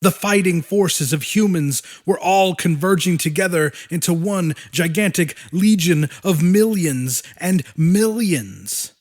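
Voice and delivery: usual speaking voice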